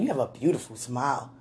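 ordinary delivery

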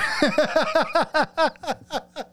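a long high cackle of real laughter; high burst of laughter. Real, not acted. Remembering the glue prank on his dad, a high burst of laughter. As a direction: crack up helplessly.

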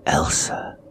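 breathless whispered call of her name; husband whispering his wife's name in fear. A narrator's reading. Husband finding his collapsed wife, whispering.

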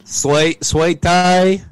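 loud, high mock Southern accent; Kevin Spacey. Host showing off sweet tea, hammed-up Southern accent. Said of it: "that's my Kevin Spacey accent".

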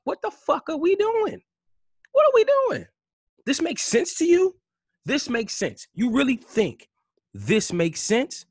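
yelling high and loud in disbelief; furious high shout. Real, not acted. Comic ranting at his own people, a furious high yell. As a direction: scream it at them, outraged. Furious rant at rioting community.

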